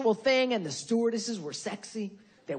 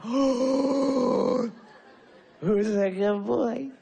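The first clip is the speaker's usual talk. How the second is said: put-on baby talk to dog; 4 dB louder, much slower than usual. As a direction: coo at the puppy. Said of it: "I turn into a total queer when I'm talking to my dog".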